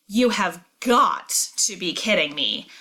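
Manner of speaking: "got" leaned on hard, the rest of the line even; stress on got. Shown as a demonstration. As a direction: punch the word "got" in "You have got to be kidding me". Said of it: "You could hear how I emphasized "got".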